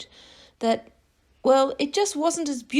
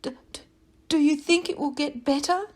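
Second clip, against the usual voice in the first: stuttering worry; 6 semitones higher than usual. Acted. Worried bird stutters a question.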